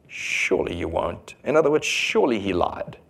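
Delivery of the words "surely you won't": low, soft, slow temptation; sly Satan. Retold or imitated. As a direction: whisper the lie low and slow. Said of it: "what Satan did in the garden to Eve".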